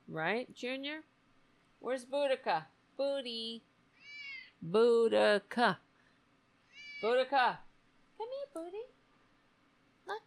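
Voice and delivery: high sing-song pet voice. Real, not acted. Cooing at her cats.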